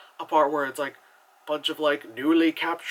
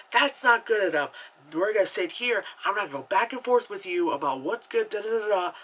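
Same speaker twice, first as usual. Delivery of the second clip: higher, louder mock protest; indignant complaint; 5 semitones higher, 3 dB louder than usual. Friend refuses the smaller restaurant table, indignant complaint. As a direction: make a fuss, pitched up and insistent.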